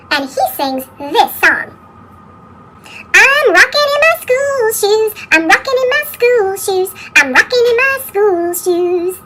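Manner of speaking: ordinary delivery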